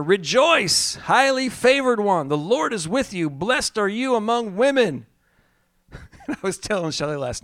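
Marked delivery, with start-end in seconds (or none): 0.0-5.1 higher, ringing angel's greeting; angel's proclamation. A narrator's reading. Preacher reading the angel's greeting to Mary, raised and ringing. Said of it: "the angel said to her".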